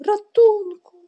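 high, loud wail for rescue; loud, plaintive distress call; desperate cry for help. A narrator's reading. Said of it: "zawołał słabym głosem".

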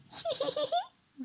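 very high, soft, slow tee-hee; giggling. A narrator's reading. Mandy hiding behind a tree, giggling at drenched Norman. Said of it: "zachichotała".

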